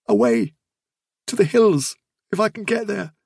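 high, wistful rabbit voice; sad Fiver. Acted. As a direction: answer from far away, pitched high. Fiver answers where he is going.

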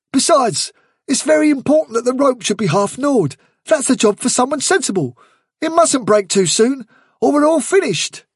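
high, emphatic character voice; insistent rabbit adviser. Acted. Blackberry persuading Hazel to wait on the boat, insistent. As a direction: press the warning home, pitched well up.

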